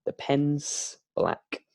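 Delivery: relaxed contraction "pen's", slow and easy; natural English. Shown as a demonstration. Teacher models the natural version to learners, relaxed and slow. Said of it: "we use natural English".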